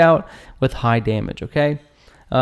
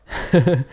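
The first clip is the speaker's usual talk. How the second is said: brief amused chuckle; short laugh. Real, not acted. Amused after apologizing for jumping around.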